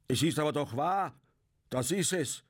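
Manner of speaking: high, forceful, irate outburst; angry cry. A narrator's reading. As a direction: cry it out in red-faced anger. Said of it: "schrie Bartle mit zornrotem Gesicht".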